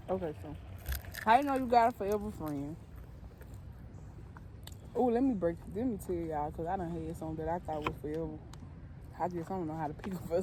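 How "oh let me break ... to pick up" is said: normal talking voice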